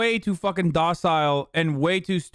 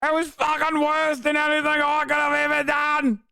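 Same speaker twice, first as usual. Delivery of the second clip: high, hammed-up Australian wail; mock Australian accent; 11 semitones higher than usual. Streamer mocking the narrator's accent attempt, hammed-up Australian accent. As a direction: go way up in a broad Australian put-on. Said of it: "Better accent than yours".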